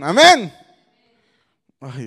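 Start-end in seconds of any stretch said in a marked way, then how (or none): 0.0-0.5 louder, higher amen call; loud prod to the crowd; rousing call. Real, not acted. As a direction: demand a livelier answer. Repeats call after weak response.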